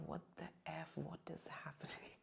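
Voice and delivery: hushed, shaken disbelief; stunned whisper. Retold or imitated. Seeing her fierce form, shocked hushed whisper. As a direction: gasp it out under breath.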